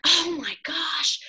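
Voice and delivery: loud, high, drawn-out dismay; shocked. Retold or imitated. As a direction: wail it slowly in retold shock. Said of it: "I was just shocked".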